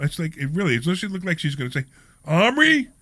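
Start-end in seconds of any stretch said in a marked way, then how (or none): 2.2-2.9 high, loud mock call of Omri's name; a shrill-free hammed-up call, pitched way up; imitating the woman. Host mocks the woman in the picture, calling Omri.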